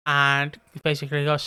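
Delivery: ordinary talk, nothing marked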